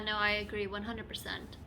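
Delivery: ordinary delivery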